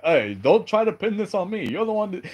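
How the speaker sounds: high-pitched mock indignation; defensive retort. [name] refuses to be blamed, mock defensive protest.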